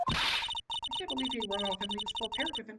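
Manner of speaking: ordinary talk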